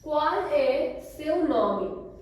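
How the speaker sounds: Qual é seu nome said very slowly, every syllable clear, rising tune; slow model phrase. Shown as a demonstration. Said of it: "So repeat it with me".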